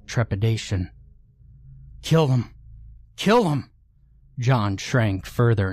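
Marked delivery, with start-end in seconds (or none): 2.0-2.5 higher frantic urging cry; frantic battle cry. Acted. Urging friend to attack. 3.2-3.7 much higher, desperate repeat of the cry; shrill frantic battle cry; frantic scream. Acted. Repeats urging louder.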